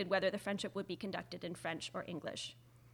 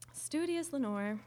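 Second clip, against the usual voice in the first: drawn-out mocking pet name, higher and slow; teasing; 6 semitones higher, slower than usual. Cécile teasing the American for studying, mock-sweet. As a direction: tease her like a bookworm.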